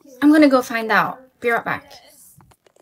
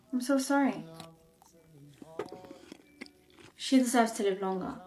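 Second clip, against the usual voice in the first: quiet sympathy; 6 semitones lower, 15 dB quieter than usual. Acted. Pitying friend with few days.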